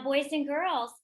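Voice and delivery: ordinary talk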